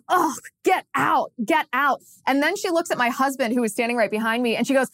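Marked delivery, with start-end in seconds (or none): 0.1-2.0 slow, higher "get out" of playful disbelief; imitating a woman brushing off the news; mock disbelief.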